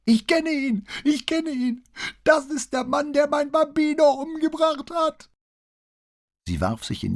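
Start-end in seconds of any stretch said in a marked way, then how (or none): 0.0-5.3 high, loud, piercing accusation; confused old mother screams at her son; old woman screaming. A narrator's reading. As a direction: screech it as a terrified old mother. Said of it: "kreischte sie mit erhobener Stimme".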